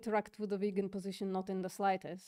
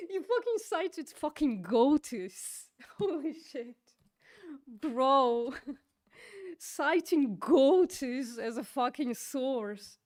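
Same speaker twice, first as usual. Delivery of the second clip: high, drawn-out words breaking into laughter; incredulous laughter; 4 semitones higher, 3 dB louder, slower than usual. Real, not acted. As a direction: laugh in disbelief as you accuse. Incredulous laughing at cited source.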